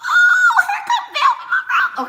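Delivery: very high, laughing shriek; excited squeal. Real, not acted. Streamer sees Velvet Monroe come in, excited squeal. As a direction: shriek with delight at a new arrival. Spots another viewer arriving.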